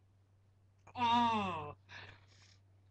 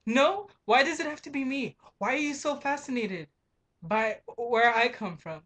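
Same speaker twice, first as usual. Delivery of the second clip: raised, indignant questions fired back; exasperated rant; 4 semitones higher, 3 dB louder than usual. Retold or imitated. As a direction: push back hard, offended and loud. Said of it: "And I'm like".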